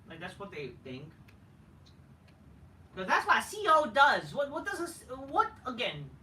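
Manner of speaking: ordinary talk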